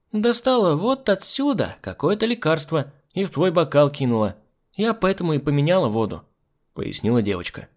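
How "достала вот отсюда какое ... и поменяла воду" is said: put-on high, animated child's voice; pert little girl. Girl revealing the medicine in the glass, pert. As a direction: explain proudly as a little girl.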